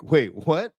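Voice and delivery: high, slow mock disbelief; baffled client. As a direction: play the puzzled investor, dragging out August. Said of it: "They're like".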